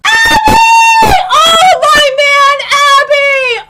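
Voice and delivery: high, loud cry of delight; excited fan shout. Real, not acted. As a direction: shout happily for your favourite character. Greets favourite character.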